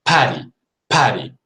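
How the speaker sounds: Patty with a puff of air on the P; explosive P. Shown as a demonstration. Teacher drilling the English P. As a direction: pop the lips on Patty. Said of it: "both of my lips become ready for the P".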